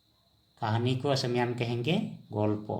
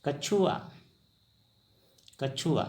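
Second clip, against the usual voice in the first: ordinary talk, nothing marked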